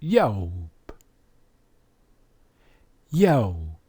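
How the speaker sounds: Yaob as "yow-b", the "ow" drawn out; guttural pronunciation. Shown as a demonstration. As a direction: demonstrate Yaob with a falling "yow" glide. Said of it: "his name is".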